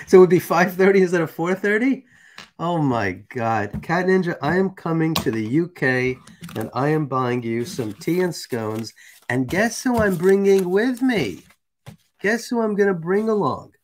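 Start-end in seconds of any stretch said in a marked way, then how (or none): none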